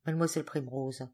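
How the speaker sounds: usual speaking voice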